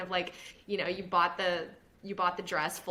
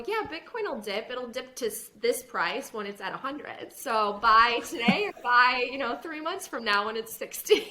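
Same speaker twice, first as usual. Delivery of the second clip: higher, louder playful sarcasm, slowing on buy today; teasing reply; 5 semitones higher, 6 dB louder than usual. Retold or imitated. Answering an Instagram follower with playful sarcasm. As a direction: tease him while giving advice. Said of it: "I go".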